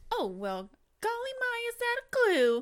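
very high, loud golly voice; hammed-up naive detective; old-timey detective. Mocking cheesy reenactment detectives.

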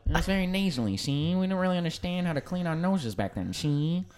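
mock 1930s tough guy, quick and nasal; James Cagney. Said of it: "you're just doing James Cagney".

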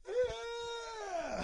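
long, high yawning uh. Real, not acted. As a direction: let a yawn take over. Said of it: "shouldn't have yawned".

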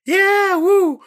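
high, loud whoop; excited cheer. Real, not acted. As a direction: cheer like a wrestling crowd. Cheering the fake wrestling promo.

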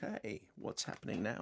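very soft, barely voiced, laid-back murmur; sleepily relaxed, trailing away to almost nothing; relaxed film star. Host imitating a relaxed actor from the interview, very laid-back.

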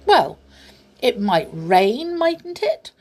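loud opening, sharply rising tag question; huffy. A narrator's reading. Teddy bear defends wanting an umbrella, in a huff. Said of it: "said the teddy bear in a huff".

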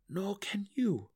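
high, slow, earnest line; earnest insistence. A narrator's reading. Drizzt tells Belwer he cannot either, earnest insistence. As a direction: draw it out high, without anger. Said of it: "he was no longer angry".

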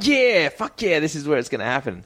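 high, thrilled whoop; excited cheer. Retold or imitated. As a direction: cheer it like a fan. Recalled excitement at action.

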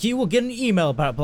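put-on customer-service insistence; Domino's staff. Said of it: "They're like".

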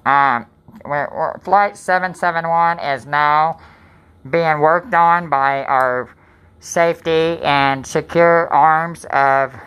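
very slow, mock captain making an announcement; lightly hammed-up intercom voice; pilot announcement. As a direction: drawl it out like an airline pilot. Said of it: "I'm trying to be the pilot".

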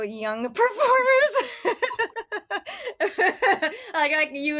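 breaking into giggles mid-question; can't keep a straight face; laughing. Real, not acted. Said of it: "I can't keep a straight face either".

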